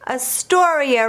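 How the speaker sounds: plain reading voice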